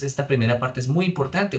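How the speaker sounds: ordinary delivery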